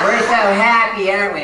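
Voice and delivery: mock happy couple. Mocking the couples pretending to be happy, saccharine. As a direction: sneer at fake couple bliss.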